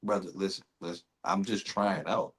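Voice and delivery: low, soft aside to the coach; hushed appeal. Acted. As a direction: quietly plead to be let off easy. Acted player appeals to coach aside.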